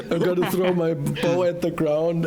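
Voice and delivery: talking through laughter; giggling mid-sentence; laughing. Real, not acted. Laughing while explaining a move.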